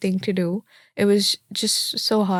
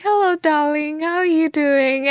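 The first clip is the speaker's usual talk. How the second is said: friendly local greeting; 7 semitones higher than usual. Retold or imitated. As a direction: greet her kindly like a Swansea local. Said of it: "And I'm like".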